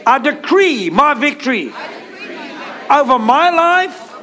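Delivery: emphatic declaration, building in pitch; like you mean it. Shown as a demonstration. Preacher leading the congregation in declaring victory, like you mean it. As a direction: proclaim it phrase by phrase for others to repeat. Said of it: "say it like you mean it".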